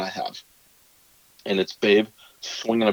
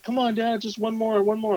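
put-on high, pleading little kid whine; begging kid voice. Kids begging dad for one more, high whiny plea. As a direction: beg like a kid stalling bedtime. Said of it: "And they're like".

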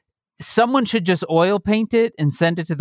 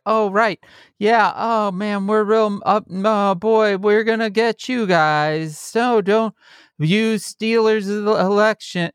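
half-hearted GOP threat; 7 semitones higher, slower than usual. Retold or imitated. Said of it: "they have to kind of be like".

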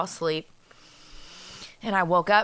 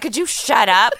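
mock annoyed raised voice; louder, higher retold scolding; irritated complaint; 5 semitones higher, 6 dB louder than usual. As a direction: imitate someone snapping at a noisy person at night. Repeats the annoyed night complaint.

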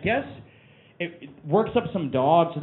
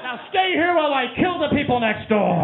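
much higher, scolding shout at a dog named Neighbors; comic owner bellowing at the dog through the walls; yelling at the dog. Retold or imitated. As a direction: holler at the dog so the neighbors hear. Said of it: "every time I yell at my dog".

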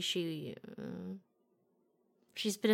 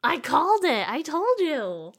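high, loud, slow told-you-so; smug victory call; gloating; 9 semitones higher, 11 dB louder, slower than usual. Real, not acted. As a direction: crow about being right, stretching it out. Gloating over a correct guess.

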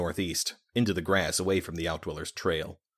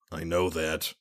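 words pushed through clenched teeth; slower than usual. Acted. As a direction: speak with jaw clamped in pain. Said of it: "speaking through clenched teeth".

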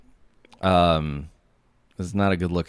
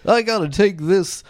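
higher, slow, jittery nervous-guy voice; Don Knotts voice; 13 semitones higher, 6 dB louder, much slower than usual. Acted. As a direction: play a bumbling, anxious little man. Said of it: "a Don Knotts kinda guy".

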